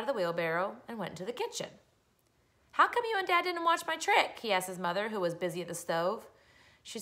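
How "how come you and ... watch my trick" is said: put-on high-pitched boyish complaint; child's complaint. Boy asks his mother why she missed his trick, complaining.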